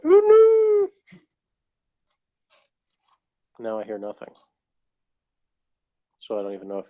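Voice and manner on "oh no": high, loud mock cry of alarm; imitating a dismayed yell. Retelling how Ben yelled when something went wrong, as a high loud mock wail. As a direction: wail it like someone who just got swallowed up. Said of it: "I heard Ben going".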